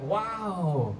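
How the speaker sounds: normal talking voice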